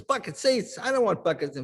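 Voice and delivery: mock grumbling father; grumpy dad. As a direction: scoff at the seats. Dad complaining, voiced.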